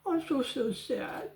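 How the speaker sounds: mock her self-pity in a put-on voice; lightly mocking sing-song imitation; mocking whiny echo. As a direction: parody the whine, sneering. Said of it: "Who talks like that?".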